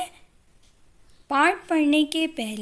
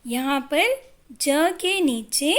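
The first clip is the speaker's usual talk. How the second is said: usual speaking voice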